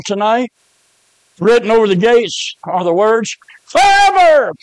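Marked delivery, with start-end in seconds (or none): 3.6-4.5 high, loud pulpit cry; one long high shout, falling at the end; proclaiming shout. Acted. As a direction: shout it out like a hellfire preacher. Words written over hell's gates, proclaimed.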